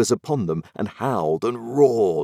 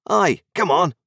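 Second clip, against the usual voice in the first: put-on cheerful pirate boy; 8 semitones higher, 5 dB louder than usual. A narrator's reading. Pirate boy urges Jack along.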